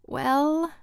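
drawn-out, noncommittal well; hedge, buying time before answering; hedging. A narrator's reading. Rod answers his pushy friend about the election, hedging. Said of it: "Rod hedged".

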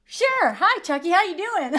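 imitating her daughter's high, cheery greeting; little girl. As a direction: chirp it brightly like a clueless small child. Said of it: "she goes".

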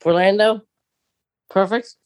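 usual speaking voice

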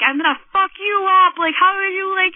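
high, loud imitation of a threatening man; mock furious shout; retold yelling. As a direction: yell the threat as the banging stranger. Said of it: "yelling at me and screaming through the window".

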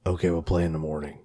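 low, soft sinister game voice; creepy villain voice. Retold or imitated. The creepy guy taunting at night, low and soft. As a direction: murmur it like a horror villain.

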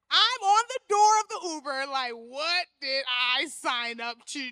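clutching the Uber door in fright; scared laughing panic; mock scared. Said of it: "I'm getting scared".

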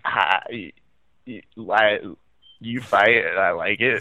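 mock starstruck stumbling over words; halting, broken attempt to get a sentence out; tongue-tied stammering. Co-host teasing Kevin's fan moment, tongue-tied stammering. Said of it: "too busy trying to formalize words".

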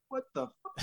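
high, hushed stunned disbelief; retold shock. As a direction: act out being stunned, high and soft. Retells shock at stunt car.

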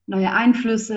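ordinary talk, nothing marked

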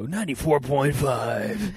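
slow, hammed-up radio DJ; douchebag radio DJ. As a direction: drag out the station call like a cheesy DJ. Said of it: "douchebag radio".

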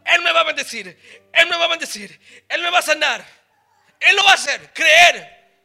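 loud, high, shouted preaching; preaching at full force. Acted. Pastor urging the congregation to believe, preaching at full force. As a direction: rouse the church to believe.

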